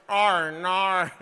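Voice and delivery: Australian vowels through a trumpet-shaped mouth; Australian accent. Shown as a demonstration. Coaching co-host on mouth shape, trumpet-mouthed Australian. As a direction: push out long, broad Australian vowels. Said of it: "make your mouth like a trumpet".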